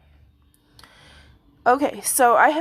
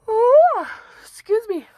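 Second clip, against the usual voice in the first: yawning; 12 semitones higher, 7 dB louder than usual. Real, not acted. Sudden yawn or hiccup mid-sentence.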